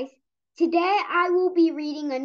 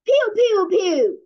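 louder, higher pew sounds; mock laser zaps; 5 semitones higher, 5 dB louder, slower than usual. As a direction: fire toy lasers.

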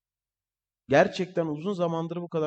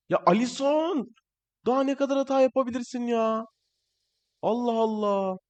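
exasperated shout; 8 semitones higher than usual. Real, not acted. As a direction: yell at the useless goalkeeper. Goalkeeper keeps making errors. (kaleciye sinirle bağırarak)

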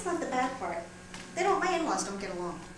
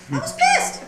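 high, loud mock fury; pissed-off outburst; 9 dB louder than usual. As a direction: burst out in exaggerated indignation. Comic retelling of own anger.

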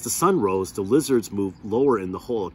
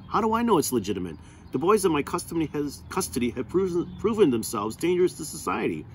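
heated defensive; 9 semitones higher than usual. Acted. Warden heatedly justifying herself.

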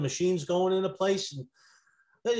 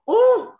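high, loud cry of alarm; startled "oh"; 9 dB louder than usual. Acted. Office staff reacting as two feared cops walk in, startled high yelp. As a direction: yelp as if caught off guard.

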